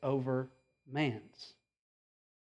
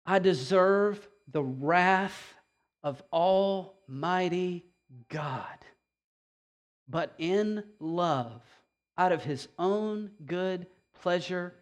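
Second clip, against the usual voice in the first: solemn recitation; slower than usual. Acted. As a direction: read it slowly with reverence. Reciting Newton's words solemnly.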